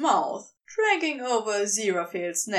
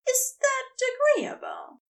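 the angel pressing for agreement; high, raised and proper; prim angel; 12 semitones higher than usual. A narrator's reading. Aziraphale negotiating.

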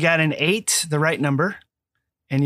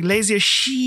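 "shit" stretched out long and rising; elongated words; 5 semitones higher than usual. Retold or imitated. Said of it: "you elongate other words".

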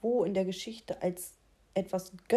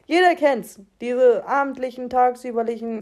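laut und hoch; aufgeregt; 3 semitones higher, 10 dB louder than usual. Real, not acted. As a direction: begeistert ausrufen. Lauter Ausruf. (aufgeregt laut)

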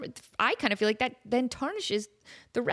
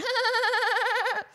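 high goat-like cackle; shrill bleating laugh; 12 semitones higher than usual. Retold or imitated. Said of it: "That sounds like the girl from".

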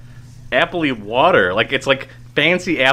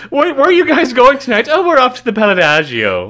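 much higher, louder snooty society voice; mock fancy socialite; 11 semitones higher, 5 dB louder than usual. Host mocking a fancy couple's evening plans as a snooty socialite. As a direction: put on airs about a night out.

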